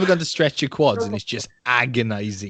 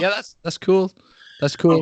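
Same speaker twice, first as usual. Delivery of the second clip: higher-pitched, over-willing okay; feigned agreement; 8 semitones higher, 4 dB louder than usual. Retold or imitated. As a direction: agree quickly just to be left alone. Feigned agreement to end the talk.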